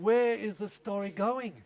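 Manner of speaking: slow, puzzled drawl; baffled husband. Retold or imitated. Husband lost in his wife's story, slow and baffled. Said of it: "And the guy's going".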